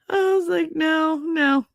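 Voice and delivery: crying with laughter; hysterical laughing. Real, not acted. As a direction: lose it laughing. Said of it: "I'm hysterical laughing".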